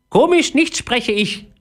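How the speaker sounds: very high, slow offended squawk; indignant parrot. A narrator's reading. Parrot denies talking funny, indignant high voice. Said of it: "sagte der Papagei empört".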